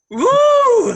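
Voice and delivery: cheer. Real, not acted. Cheering after muting him.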